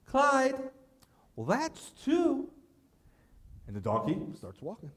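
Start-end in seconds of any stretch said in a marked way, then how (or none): 0.1-2.5 high, slow, deadpan warning; stern wife warning. Retold or imitated. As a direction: count off slowly and coldly, higher. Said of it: "old wife's second warning".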